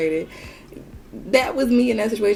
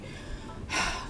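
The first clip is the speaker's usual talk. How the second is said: mock heavy exhale; conflicted sigh; 10 dB quieter than usual. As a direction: sigh softly, imitating his reluctance. Imitating his conflicted hesitation.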